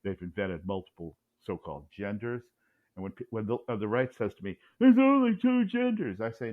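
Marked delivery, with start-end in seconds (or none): 4.8-6.2 high, loud mocking imitation; mock indignant zealot. Imitating right-wingers lecturing him, high mock indignation. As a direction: parrot the slogan in a high, strident put-on voice.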